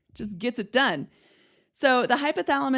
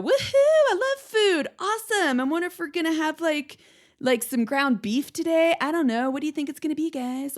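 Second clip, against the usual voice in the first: much higher, louder, gushing over food; excited dog; 8 semitones higher, 5 dB louder than usual. Pretend. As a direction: squeal with delight about dinner like an eager dog. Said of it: "you hear the bell and you go".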